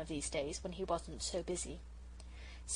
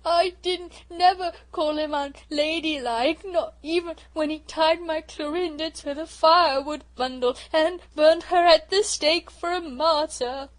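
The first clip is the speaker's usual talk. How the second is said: sobbing; 8 semitones higher, 12 dB louder than usual. A narrator's reading. Hurt little sister sobs to Bobbie. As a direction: blubber it out, choking on the word. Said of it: "sobbed Phyllis".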